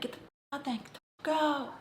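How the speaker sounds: high, strained imitation of anger; imitated angry order. Boyfriend throwing girlfriend out of car, imitated angry order. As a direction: act out him ordering you out.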